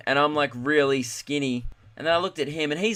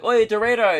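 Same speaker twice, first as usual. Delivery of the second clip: luring a shark; 7 semitones higher, 5 dB louder than usual. Pretend. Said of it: "you'd be like".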